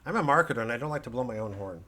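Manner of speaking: usual speaking voice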